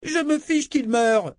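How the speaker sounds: put-on high, slow, enraged scream of a spoiled girl; furious child shout. A narrator's reading. Princess furious that Dena protects Richard, screaming high. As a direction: shriek it out of control. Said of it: "cria Violette hors d'elle".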